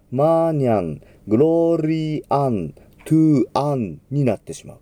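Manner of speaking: final M said as an open N, lips never closing; マーニャン with lips apart, ending in N; lips left open. Shown as a demonstration. Teacher showing the wrong way, lips open on the final M. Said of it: "唇が閉じないと".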